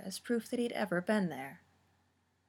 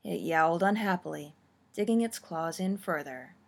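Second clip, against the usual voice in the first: usual speaking voice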